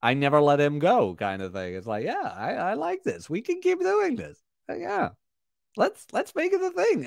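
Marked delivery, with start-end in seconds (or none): 2.0-7.0 squeaky, much higher put-on voice; mock Rob voice. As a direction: play the guest as a cartoon. Voicing Rob agreeing, hammed up.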